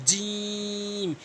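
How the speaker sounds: জিম stretched very slowly, firmly from the palate; forceful jim. Shown as a demonstration. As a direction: drag out জিম with firm force. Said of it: "এরকম শক্তি দিয়ে শক্ত করে". (শক্তি দিয়ে টেনে জিম)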